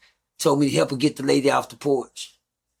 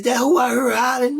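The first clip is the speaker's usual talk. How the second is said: high, louder mother's startled question; mother asking; 11 semitones higher, 4 dB louder than usual. Retold or imitated. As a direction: voice mom checking the yelling she heard, high. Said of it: "My mama says".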